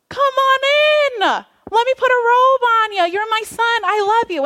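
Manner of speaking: a father flinging open his arms to his lost son; welcoming shout of joy; overjoyed father. Acted.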